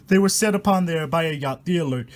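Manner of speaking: usual speaking voice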